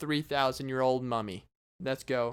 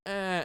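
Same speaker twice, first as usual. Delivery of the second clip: brief higher-pitched mummy bleat; mummy groan imitation; 4 semitones higher than usual. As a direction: mimic the mechanical "eh". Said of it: "then be like".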